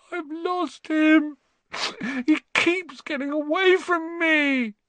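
put-on high, slow, wailing boy. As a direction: wail it like a child near tears. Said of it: "he wailed".

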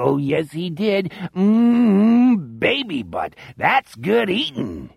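high, slow, sickly relishing monster voice; gloating cannibal monster. A narrator's reading. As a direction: savor every word like a ghoul chewing. Cannibal killer gloats while eating.

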